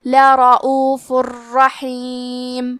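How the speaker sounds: slow recited "لرعوف الرحیم" with the tanween merged into the ra, no nasal sound; tajweed recitation demo. Shown as a demonstration. Teacher demonstrates the merging rule to students, slow recitation. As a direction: chant it slowly, tanween merged into the ra. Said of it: "یہ حکم کو ادا کریں گے".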